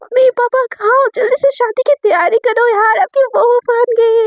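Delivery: high, bubbly shout for parents; bubbly overjoyed call; giddy joy. A narrator's reading. Sakshi dancing with joy.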